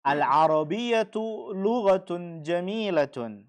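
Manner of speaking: slow and deliberate; model sentence. Shown as a demonstration. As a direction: read it slowly so students can follow. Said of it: "teacher models sentence for class". (pelan dan jelas seperti guru)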